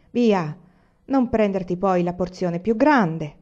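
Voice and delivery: usual speaking voice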